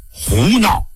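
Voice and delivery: angry rebuke. A narrator's reading. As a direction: cut her off in anger. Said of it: "怒吼道".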